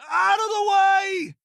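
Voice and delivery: very high, slow shouted threat; aggressive battle shout. Acted. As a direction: roar it as you charge in. Combat shout at enemies.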